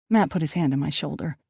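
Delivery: ordinary delivery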